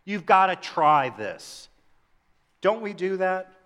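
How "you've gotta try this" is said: higher, slow, eager push; with energy. Shown as a demonstration. Pastor urging friends to try a great restaurant, energetic. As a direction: sell it like you loved it. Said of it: "with energy".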